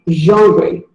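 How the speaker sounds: genre as zhon-ruh; genre, the model way; correct pronunciation. Shown as a demonstration. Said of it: "It is pronounced as".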